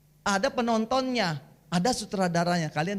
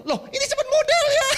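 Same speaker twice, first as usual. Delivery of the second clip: very high, loud mock protest; hammed-up indignant yelp with laughter; angry retort; 7 dB louder than usual. Husband re-enacts snapping back at his wife, comically angry. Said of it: "Saya juga marah".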